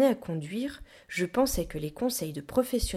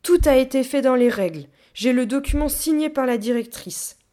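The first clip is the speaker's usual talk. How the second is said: higher, loud indignant protest; gorilla on the defensive; 6 semitones higher, 10 dB louder than usual. A narrator's reading. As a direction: insist firmly that all was in order. Gorilla, figure in read story. (protester fort, sur la défensive)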